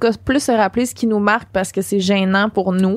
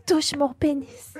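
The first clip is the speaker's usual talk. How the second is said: quieter, slower mock seductive line; mock sultry whisper; 5 semitones higher, 6 dB quieter, slower than usual. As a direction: lightly imitate her sultry tone. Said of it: "Elle dit".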